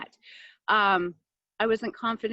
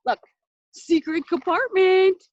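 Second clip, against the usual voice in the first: playful reveal; 9 semitones higher, 7 dB louder than usual. Pretend. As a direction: unveil it like a hidden treasure. Reveals hidden compartment playfully.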